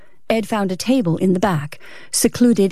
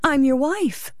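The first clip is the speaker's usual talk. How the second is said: higher-pitched, anxious appeal; anxious wife; 7 semitones higher, 3 dB quieter than usual. A narrator's reading. Wife with her hand on his, anxious appeal. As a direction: lift the voice into an anxious wife's plea. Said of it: "put her small hand anxiously on his".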